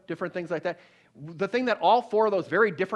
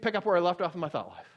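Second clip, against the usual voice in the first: ordinary delivery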